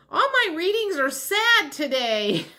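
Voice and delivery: high, laughing through the words. Real, not acted. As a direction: laugh it out in disbelief. Laughs at sad readings.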